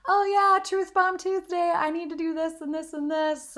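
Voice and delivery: hammed-up peppy voice for Truth Bomb Tuesday; mock eager self. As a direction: parody her overeager past self. Mocks her past eager self.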